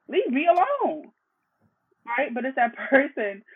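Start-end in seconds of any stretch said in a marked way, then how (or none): 0.1-1.1 high exasperated plea; mild mock exasperation, pitched up; frustrated. Partner blamed over bread, high fed-up sigh of a plea. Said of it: "you're frustrated because you're like".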